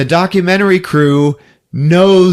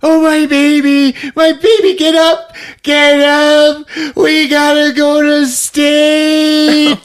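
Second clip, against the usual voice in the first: high, loud, drawn-out mock wail; hammed-up bawling, stretching the words; wailing cry; 4 dB louder, slower than usual. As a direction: wail like a hysterical grieving mother. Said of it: "she's crying".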